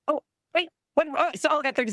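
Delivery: high, startled oh wait; catching herself just before leaving; sudden surprise. Real, not acted. Teacher at the podium suddenly remembers her time, startled and high.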